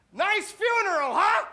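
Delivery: high, loud, slow shout; yelling to a neighbour at a funeral; yelled. Pretend. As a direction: yell it across the funeral. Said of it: "turning to your neighbor and saying".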